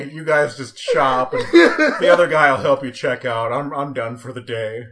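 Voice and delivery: loud, laughing mock surrender; mock shamed cashier. Imitating himself to friends, laughing mock shame. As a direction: ham up slinking off in shame, half laughing. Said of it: "hang my head and just go".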